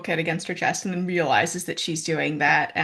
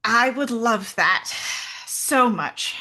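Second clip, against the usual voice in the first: calling out; 4 semitones higher, 3 dB louder, slower than usual. Acted. Calling out, relieved.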